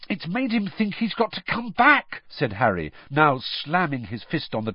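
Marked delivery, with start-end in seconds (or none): 0.1-2.2 high-pitched, angry outburst; furious. A narrator's reading. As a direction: burst out in frustration. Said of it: "Harry said furiously".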